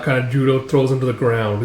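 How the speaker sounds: ordinary delivery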